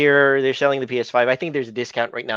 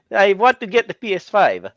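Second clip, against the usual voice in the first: pitched-up playful echo of the neighbor voice; mock imitation; 6 semitones higher than usual. Teasing the guest by echoing his neighbor impression, pitched up. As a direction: toss the line back higher, teasing. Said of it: "The way that you said".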